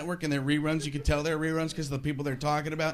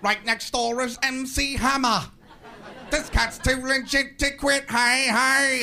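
Robin Leach; 6 semitones higher than usual. Retold or imitated. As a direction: ham it up like a gossip host. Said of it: "Robin Leach isn't laughing".

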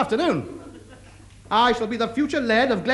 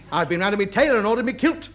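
ordinary talk

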